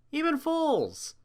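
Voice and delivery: ordinary delivery, nothing marked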